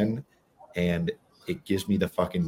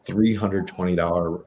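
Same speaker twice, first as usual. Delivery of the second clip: ordinary talk, nothing marked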